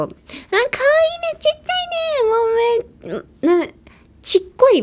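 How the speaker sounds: high, cooing gush over something tiny; cutesy gushing. Shown as a demonstration. Gushing over something tiny, high cooing voice. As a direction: coo like it's adorable. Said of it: "みたいな感じのニュアンスがあります".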